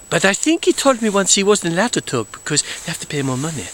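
usual speaking voice

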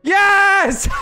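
high, loud victory cry; triumphant yell. Real, not acted. Gamer cheering as Doctor Octopus gets in, loud. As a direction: cheer the win.

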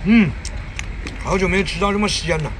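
ordinary delivery

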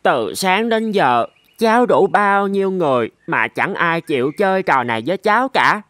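ordinary delivery, nothing marked

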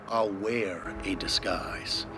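ordinary talk, nothing marked

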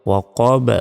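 "ওয়াকব" with a pushed, echoing final b; "ওয়াকব" ending in a popped b; qalqalah bounce. Shown as a demonstration. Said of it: "শেষে ধাক্কা দিবেন".

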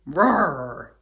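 put-on a low, loud lion roar for the kids. Shown as a demonstration. Teacher showing kids the lion's sound, playful roar. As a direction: growl out a big lion roar. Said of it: "what sound do they make?".

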